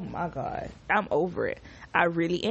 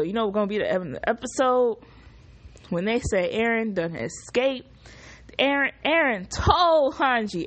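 usual speaking voice

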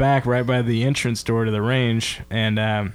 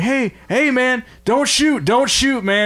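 retold yell; 10 semitones higher, slower than usual. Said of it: "yelling at my buddy".

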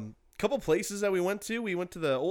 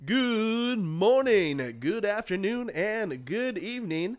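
radio host voice; 5 semitones higher, slower than usual. Acted. Podcast host greeting.

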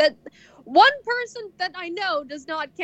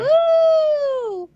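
excited whoop; 12 semitones higher, 4 dB louder than usual. Real, not acted. Cheer on leaving.

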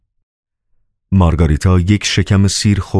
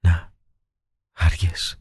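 to herself, softly; 7 dB quieter, slower than usual. A narrator's reading. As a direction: murmur it privately, barely voiced. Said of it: "با خود گفت".

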